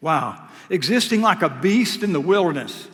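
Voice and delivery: usual speaking voice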